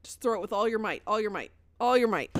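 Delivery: normal talking voice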